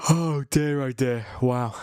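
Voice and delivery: sighing through a yawn. Real, not acted. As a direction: yawn while saying oh dear. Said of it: "I can't stop yawning".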